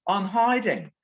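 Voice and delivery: high, confiding Cockney lad imitation; Cockney boy. Boy admits hiding from his date, high Cockney imitation. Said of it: "who's also a Cockney".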